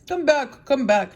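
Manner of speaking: loud call, starting high. A narrator's reading. Tin Woodman to the fleeing mice, calling out. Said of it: "while he called to the mice".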